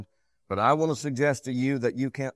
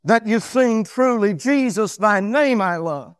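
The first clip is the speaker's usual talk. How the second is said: loud, high pulpit delivery; raised preacher's voice, driving each phrase; full-force preaching; 9 semitones higher, 10 dB louder than usual. Real, not acted. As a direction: preach it out strongly, high and emphatic.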